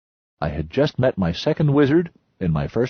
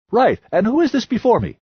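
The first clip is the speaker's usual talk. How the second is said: loud, higher lecturing voice of the history buff; loud know-it-all; 14 semitones higher, 5 dB louder than usual. A narrator's reading. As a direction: hold forth like a pompous expert. The loudest voice.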